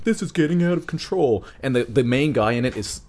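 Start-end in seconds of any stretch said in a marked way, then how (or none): 0.0-1.4 arguing. Retold or imitated. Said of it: "they're arguing".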